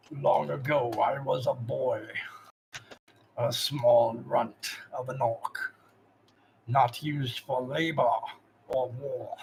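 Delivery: slow, steady storytelling orc; lightly voiced orc master. Zog tells the player of his youth, slow orc voice. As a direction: tell it like a proud old orc.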